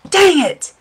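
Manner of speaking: sudden high-pitched frustration; exasperated. Retold or imitated. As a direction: yelp it in dismay. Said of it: "I'm like".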